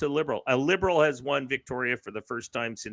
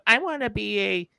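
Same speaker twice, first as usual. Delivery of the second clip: mocking student; 7 semitones higher than usual.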